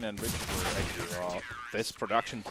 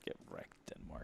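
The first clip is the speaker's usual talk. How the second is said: whispering; 18 dB quieter than usual. Real, not acted. Taunting Denmark after a kill.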